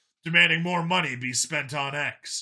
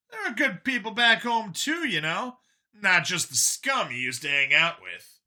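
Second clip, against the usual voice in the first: higher, lighter voice for Brian; Brian's voice; 6 semitones higher than usual. A narrator's reading. Brian pushing back at Vincent, higher voiced. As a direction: voice the younger friend Brian, a bit higher. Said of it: "Brian speaking in story".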